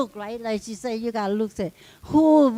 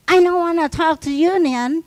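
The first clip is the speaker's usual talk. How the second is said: loud, higher imitation of a rude coworker's brush-off; 5 semitones higher, 9 dB louder than usual. Retelling the Filipino lady's rude refusal, in a loud mock snap. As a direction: bark it back like the snippy lady at the table. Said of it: "some people attitude stink".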